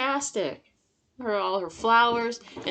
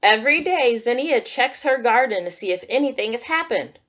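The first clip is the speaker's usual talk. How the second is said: put-on reading to children; 5 dB louder than usual. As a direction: read it like storytime for little kids. Reads picture book to children.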